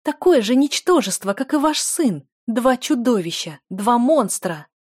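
high, loud angry outburst; furious. A narrator's reading. Дель denouncing the lord and his son, furious. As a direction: hurl the insults furiously. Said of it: "рычала Дель".

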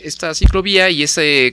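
normal talking voice

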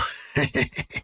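nasty breathy snicker; spiteful giggle. Acted. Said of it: "Привратник вредно захихикал".

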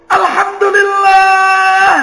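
slow, loud, high cry of thanks; joyful praise shout, high and loud. Shown as a demonstration. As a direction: shout it out in joy. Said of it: "sampai kadang orang teriak gitu kan".

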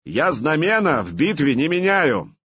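high, firm and proud; proud answer. Acted. Artyom refuses to change sides, proud answer. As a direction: answer with dignity, head held high. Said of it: "гордо ответил Артём".

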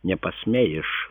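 plain reading voice

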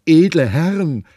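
loud, wheedling poor devil pleading; hammed-up rogue fawning on soldiers; loud cringing trickster offering drinks; bawling beggar. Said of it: "Da plärrte Gymnastes".

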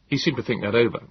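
ordinary delivery, nothing marked

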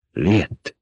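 hushed, half-whispered name. A narrator's reading. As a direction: breathe the name as a light whisper. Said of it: "az egyik azt suttogta".

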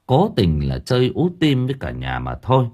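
usual speaking voice

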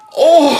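loud, high rapturous "Oh"; ecstatic cry. Acted. Ecstatic cry before death. (lauter, hoher verzückter Ausruf)

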